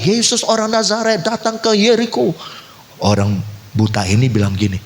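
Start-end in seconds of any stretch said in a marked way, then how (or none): 0.0-2.3 mock crowd yell, pitched high; excited announcement, imitating a shouting crowd; high-pitched retold shout without much volume. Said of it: "dia dengar orang-orang teriak".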